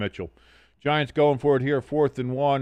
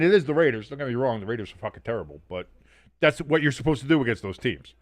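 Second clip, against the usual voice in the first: ordinary talk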